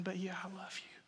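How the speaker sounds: whispered, ashamed I love you; whispered confession. Acted. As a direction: breathe it out, humbled. Peter answers Jesus in shame.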